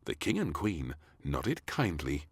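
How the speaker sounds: ordinary delivery, nothing marked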